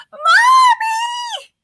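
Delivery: put-on high shrill child's scream of Mommy. Shown as a demonstration. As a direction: scream it like a small child calling. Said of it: "I'll give you the modified".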